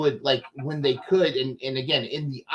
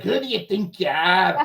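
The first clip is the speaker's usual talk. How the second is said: lightly Scottish, laughing mock challenge; Scottish dad impression; 4 semitones higher than usual. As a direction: tease like a Scottish curriculum, half-laughing. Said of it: "dad's impersonation".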